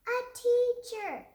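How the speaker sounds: loud, high, slow shout; loud high excited shout; shouted answer. Acted. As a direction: yell it together like a class.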